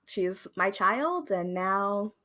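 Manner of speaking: usual speaking voice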